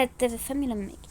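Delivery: mysterious whispered promise; secretive whisper. Acted. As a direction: hush it like a secret.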